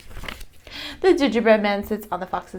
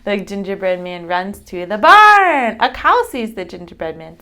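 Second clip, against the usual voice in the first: ordinary delivery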